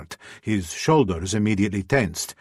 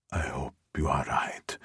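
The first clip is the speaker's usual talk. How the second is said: whispering; 5 semitones lower, 6 dB quieter than usual. Acted. Whispered reply in bed.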